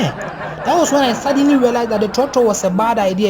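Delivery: ordinary talk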